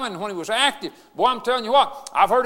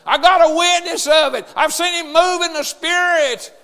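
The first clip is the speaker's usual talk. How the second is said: loud, high, ringing revival preaching that builds to a shout; full-force preaching; 4 semitones higher, 5 dB louder than usual. Acted. Funeral preacher rousing mourners to get saved, loud preaching. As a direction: lift the congregation with every line.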